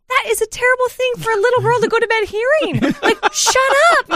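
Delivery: high-pitched mock scolding; exasperated inner voice. Mother retells what she thought at bedtime, high, comic exasperation. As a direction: squeal it as a pent-up silent rebuke.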